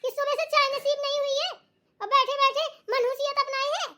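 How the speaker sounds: ऊँची, चुभती शिकायत वाली सास की आवाज़; ऊँचे सुर में बहू को ताना मारती सास; झगड़ालू सास. Acted. (नाराज़ सास का उलाहना)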